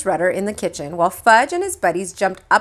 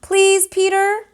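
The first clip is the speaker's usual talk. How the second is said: high, slow pleading; 12 semitones higher, slower than usual. A narrator's reading. Mother begging Peter to help, high slow plea. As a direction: beg him, drawn out.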